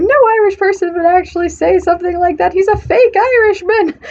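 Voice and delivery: high-pitched imitated outrage, breaking into laughter; mock indignant outburst. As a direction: call out a fraud, hammed-up and squeaky high. Acts out man calling out Jack.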